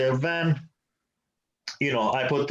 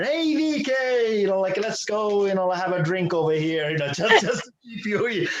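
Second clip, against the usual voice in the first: friend calling out; 9 semitones higher than usual. Retold or imitated. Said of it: "Justin was over there".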